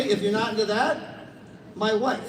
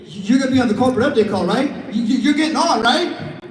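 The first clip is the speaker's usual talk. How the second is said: fast, loud pressuring push; mock pressure call to a reluctant partner, rushed and loud; pushy phone pitch; 7 dB louder than usual. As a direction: rush them onto the call. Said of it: "call Daryl Maria Roberts and say".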